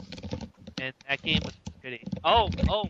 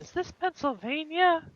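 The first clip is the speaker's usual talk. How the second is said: high put-on voice; squeaky mock question; high silly voice; 13 semitones higher than usual. Putting on a silly voice.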